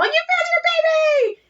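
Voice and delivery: very high, cheering celebration; high mock cheer. Cheering the returning dad, high squealed cheer. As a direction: squeal it like it's the best news ever.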